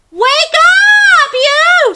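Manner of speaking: shrieked very high and loud; screaming rooster. Acted. Chicken Chuck waking the sleeping monkey, high scream. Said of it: "screamed Chicken Chuck".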